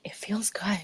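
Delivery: soft whispered relief. Retold or imitated. On the summit after crying, whispered relief. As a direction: breathe it out as a whisper. Quiet moment atop mountain.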